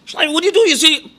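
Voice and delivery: high, singsong mock mother scolding; scolding mom. Mother on the playground scolding her son Shlomo, high mock singsong. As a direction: voice a mom calling her boy off the swing. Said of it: "His mom looks and goes".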